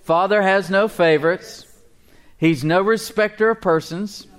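high, loud declaration for all to repeat; emphatic call-and-response preaching; leading group repetition. Shown as a demonstration. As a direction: proclaim it for the congregation to echo. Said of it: "Say it with me".

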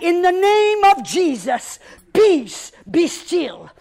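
forceful praying declaration, high and loud; loud bold prayer. Retold or imitated. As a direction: command peace boldly, building to a cry on peace. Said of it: "I start speaking out".

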